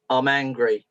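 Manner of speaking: low, terse sulking reply; sullen little boy. Retold or imitated. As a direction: imitate a miserable boy giving nothing away.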